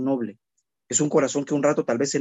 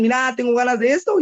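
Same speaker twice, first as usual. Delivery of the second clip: higher, eager little voice asking for something; child asking; 8 semitones higher than usual. Retold or imitated. Child asking parent for a treat, eager and higher. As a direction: ask like a kid who just wants it. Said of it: "los niños solo piden".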